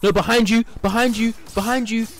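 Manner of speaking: high, loud urgent warning; alarmed shout. Real, not acted. Streamer warning in a fight, high loud panic.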